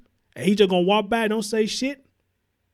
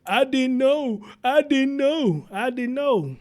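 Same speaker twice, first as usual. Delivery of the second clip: slow, whiny, higher pleading; mimicking the woman; 6 semitones higher, slower than usual. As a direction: drag out each "I didn't know" like a weak excuse. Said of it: "this woman call you".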